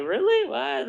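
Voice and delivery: high-pitched mock disbelief; imitating amazed young people; incredulous. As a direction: squeak it like a baffled youngster.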